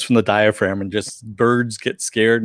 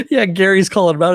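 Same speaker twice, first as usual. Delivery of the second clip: usual speaking voice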